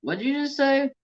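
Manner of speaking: high-pitched mock incredulous question; imitating questioning boss. Boss questioning what was said, imitated high incredulous voice. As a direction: play the boss, voice jumping way up. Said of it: "And he goes".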